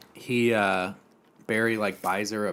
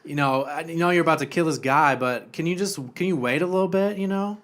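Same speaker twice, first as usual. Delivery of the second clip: higher, lilting camp henchman; sweetly wheedling, pitched up; lightly camp voice; 5 semitones higher, 4 dB louder than usual. Retold or imitated. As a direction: make an apologetic request in a put-on camp voice. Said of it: "he kinda sounds like that".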